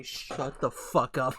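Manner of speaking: imitated slow, carefully separated words; "Shut the fuck up" drawn out slowly, every word distinct; very clear enunciation. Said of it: "he said in the most clear".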